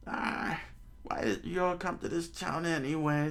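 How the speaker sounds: put-on high-pitched questioning old-timer; old man. Old man asks pirates about the town, high voice. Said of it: "the old man said".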